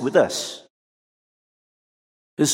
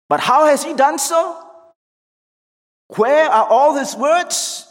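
high, raised-voice challenge; casting doubt; 11 semitones higher, 4 dB louder than usual. Acted. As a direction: demand answers in loud rising questions. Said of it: "Cast a doubt".

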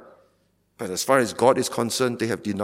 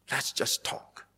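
soft breathy mocking repeat of "Let's"; mocking whisper; 10 dB quieter, slower than usual. Shown as a demonstration. Preacher mocking the pastor's email in a hushed whisper. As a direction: hush it like a sly aside.

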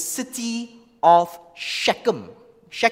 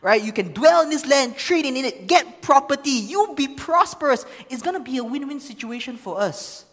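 salesman pitch; 6 semitones higher, 4 dB louder than usual. Retold or imitated. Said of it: "He says, he offers some great offer".

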